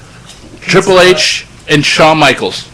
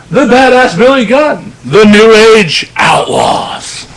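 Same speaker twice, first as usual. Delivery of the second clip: booming ring announcer intro; grand entrance announcement; 5 semitones higher than usual. Retold or imitated.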